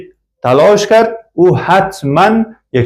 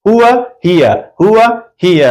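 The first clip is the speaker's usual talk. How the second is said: هو then هی, set apart and raised; contrast drill; 6 semitones higher than usual. Shown as a demonstration. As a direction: drill the pair هو هی for the class. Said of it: "اونجا گفته بودیم هو". (هو و هی را با تأکید و بلند تکرار کن)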